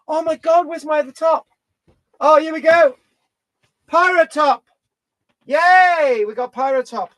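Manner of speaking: high, loud, delighted cry; excited. Real, not acted. Performer finding his missing pirate top, excited.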